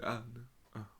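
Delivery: mumbled, soft and faint; a hint of a mumbling Christopher Walken. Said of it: "Christopher Walken's like".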